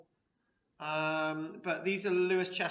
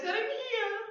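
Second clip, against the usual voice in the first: very high-pitched comic husband; eager storybook husband; 13 semitones higher than usual. Acted. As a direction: show her the find in a high little voice.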